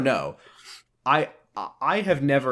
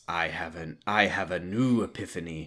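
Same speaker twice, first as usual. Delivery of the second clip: low character voice; 7 semitones lower, 6 dB quieter than usual. Acted. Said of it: "And then she says".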